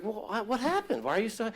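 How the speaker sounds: high-pitched doting mother pressing her daughter. Retold or imitated. Mother quizzing her daughter home from school, high puzzled voice. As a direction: pitch up into an eager, questioning mom. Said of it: "And she said".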